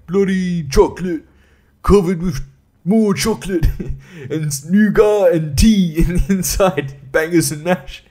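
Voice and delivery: a put-on posh British drone mocking the chocolate; mock British accent. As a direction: lampoon the British sweets in a silly accent.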